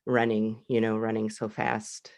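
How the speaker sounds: normal talking voice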